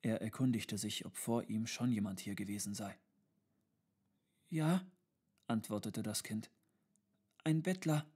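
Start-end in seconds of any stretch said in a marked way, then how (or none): 4.5-4.9 lightly voiced child, higher pitch; a hint of a child answering; small child. A narrator's reading. 7.4-8.1 lightly voiced child, higher pitch; a hint of a child, pitch raised; small child. A narrator's reading. Child answers tutor.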